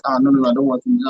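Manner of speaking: usual speaking voice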